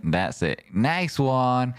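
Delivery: ordinary talk, nothing marked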